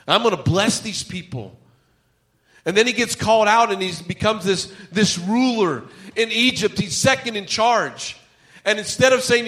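impassioned preaching. Acted. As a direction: preach it with mounting urgency. Preaches with raised force.

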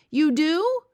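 high, loud delighted question; light up with surprised joy; suddenly brightening. A narrator's reading. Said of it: "cried the B F G, suddenly brightening".

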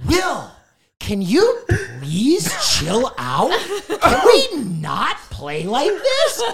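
high, slow, exaggerated scolding; Pokimane. Dead player unmuting to scold the newcomer, mock-exasperated. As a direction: imitate a teammate's drawn-out, exasperated reprimand. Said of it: "Pokimane unmutes from death and goes".

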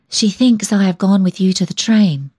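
ordinary delivery, nothing marked

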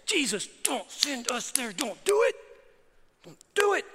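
mock panicked plea, rising high; pleading demons. Preacher acts demons begging Jesus, high mock-desperate pleading.

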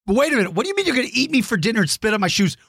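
high, fast, playful disbelief; mock outrage. Real, not acted. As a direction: pretend to be shocked by the lyrics.

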